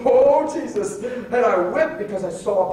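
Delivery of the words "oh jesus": a loud startled cry; mild retold panic; alarmed cry. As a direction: cry out at the near crash. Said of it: "I remember going".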